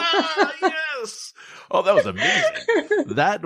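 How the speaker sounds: squealing high with laughter; thrilled, laughing through it; delighted laugh. Real, not acted. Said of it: "reacts to the low voice".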